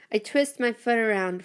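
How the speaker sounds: plain reading voice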